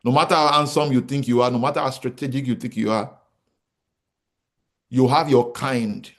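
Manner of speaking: normal talking voice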